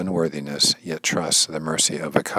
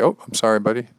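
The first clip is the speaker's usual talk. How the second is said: higher, lightly comic apology; apologetic; 6 semitones higher, 4 dB louder, faster than usual. Pretend. Speaker imagining apologizing to his wrestling opponent, apologetic. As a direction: blurt a casual mock apology. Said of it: "I'd probably say".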